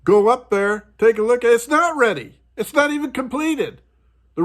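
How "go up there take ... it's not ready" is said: usual speaking voice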